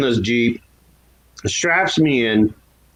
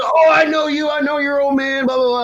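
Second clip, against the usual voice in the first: recognizing cop; 13 semitones higher than usual. Retold or imitated. Sergeant telling the teen he knows his dad, mock. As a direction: play the cop who knows his family.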